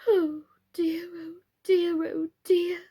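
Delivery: high, breathless whispered lament; fretful whisper. A narrator's reading. Mr Plantagenet whispering over the ruined house, high and despairing.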